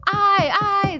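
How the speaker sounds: high, loud chorus of ayes; clamor your vote; crowd clamoring. Acted. Ghost crowd answering the vote, clamoring loudly. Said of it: "the crowd clamored".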